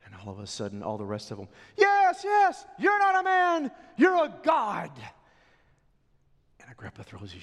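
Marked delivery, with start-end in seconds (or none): 1.8-4.9 high, loud cheering shout; the whole crowd roars agreement; full-throated acclaim for the king. Acted.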